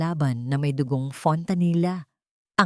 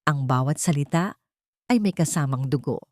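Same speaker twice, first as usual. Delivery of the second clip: plain reading voice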